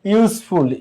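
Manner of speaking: ordinary talk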